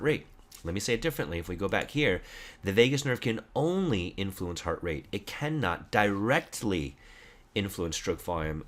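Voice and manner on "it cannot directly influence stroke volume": "directly" drawn out slowly for emphasis; slow, deliberate weight on "directly", then drop off; stress on directly. Real, not acted. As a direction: lean hard on the word "directly", stretching it. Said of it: "hopefully you hear my emphasis on the word directly".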